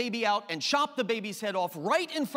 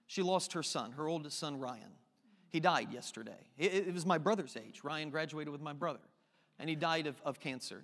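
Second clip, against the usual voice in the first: choked up, grieving; 6 semitones lower, 6 dB quieter than usual. Real, not acted. Preacher tells church of friend's son dying, quietly grieving. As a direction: voice sinking low and soft with grief.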